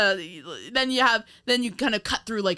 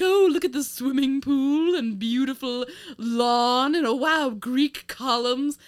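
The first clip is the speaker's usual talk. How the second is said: higher, louder, slow gushing society narrator; mock gossip columnist; 5 semitones higher, 4 dB louder than usual. Podcaster parodying a cartoon voiceover narrator, slow and gushing. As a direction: gush over the estate like an old Hollywood columnist. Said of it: "it's like a parody of that".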